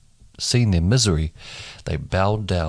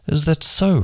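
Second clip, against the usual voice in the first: a raised, scoffing "is that so"; scornful retort; 9 semitones higher than usual. A narrator's reading. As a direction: toss it back with mild disdain. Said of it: "retorted Pharaoh".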